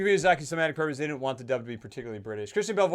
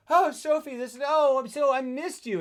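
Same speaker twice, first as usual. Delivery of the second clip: high-pitched, gushing reunion act; mock-delighted mother, pitched way up; returning mother's gushing greeting; 11 semitones higher, 5 dB louder than usual. Mother greets long-unseen daughter, mock gushing. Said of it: "when the mother comes back and is clearly this".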